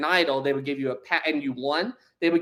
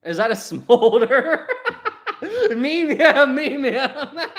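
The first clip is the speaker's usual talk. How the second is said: high, loud, words broken by laughs; real cracking up; helpless laughter; 10 semitones higher, 5 dB louder, much slower than usual. Real, not acted. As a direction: laugh so hard the words barely come out. Cracks up at own smolder.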